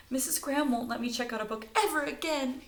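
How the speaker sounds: high, loud worried whine; fretting. Acted. Stella fretting as she runs to the diner. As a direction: fret aloud. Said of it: "Stella fretted".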